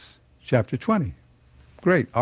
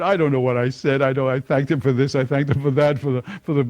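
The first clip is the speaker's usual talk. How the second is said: normal talking voice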